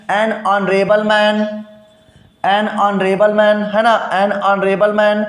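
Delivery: "an honorable man" said slowly, "honorable" as "onorable"; slow model pronunciation. Shown as a demonstration. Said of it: "हमने कहा an honorable".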